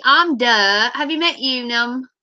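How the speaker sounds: ordinary talk, nothing marked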